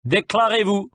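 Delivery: high-pitched French sentry's demand; commanding order. A narrator's reading. Sergeant demands strangers identify themselves, commanding. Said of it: "so he ordered".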